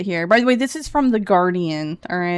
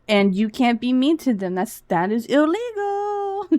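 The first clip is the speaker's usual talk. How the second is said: mock scolding; 3 semitones higher than usual. Mock scolding on women's behalf.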